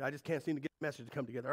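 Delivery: fast frustrated rush; rushed complaint. Retold or imitated. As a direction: tumble out the worry quickly. Quoting himself to wife.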